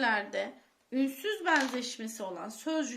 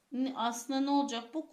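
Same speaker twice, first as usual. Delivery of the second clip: normal talking voice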